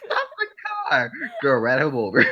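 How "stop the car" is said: much higher mock panic; high panicked yell. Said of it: "He said".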